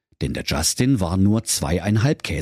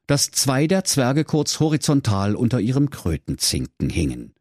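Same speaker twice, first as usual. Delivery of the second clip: usual speaking voice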